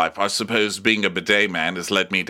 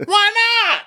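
very high, loud squawked comeback; hammed-up outraged question; mock indignant yell; 6 dB louder than usual. Acted quarrel over an apology, mock high yell.

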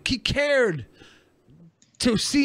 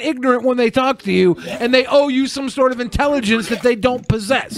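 higher-pitched, heated ranting; real exasperated rant; 5 semitones higher than usual. Real, not acted. Storyteller defending his patience with a stranger, exasperated rant. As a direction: build into an indignant tirade.

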